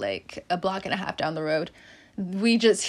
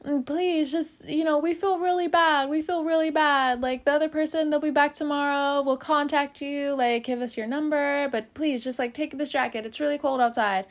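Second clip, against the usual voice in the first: pleading; 7 semitones higher than usual. Retold or imitated. Frat boys begging her to take the jacket, higher pleading voice. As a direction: plead like a sorry drunk frat boy.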